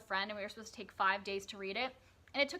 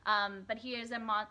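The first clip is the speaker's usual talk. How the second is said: usual speaking voice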